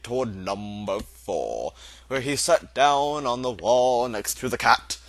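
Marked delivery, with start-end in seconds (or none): none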